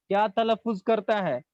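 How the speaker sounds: usual speaking voice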